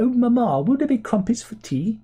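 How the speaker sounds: high, loud posh English mock accent; plummy English. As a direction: ham up a plummy upper-class English accent. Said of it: "very plummy".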